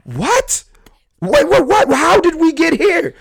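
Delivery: very high, stunned "wait, what?"; disbelief. Retold or imitated. Son stunned by his mom's outburst, very high. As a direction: squeak out total confusion.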